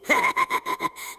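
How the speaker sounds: high, shrieking villain cackle; evil laugh. Acted. Villain cackling at the one he'll eat. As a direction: cackle over your prey, way up high. Villain gloats before eating.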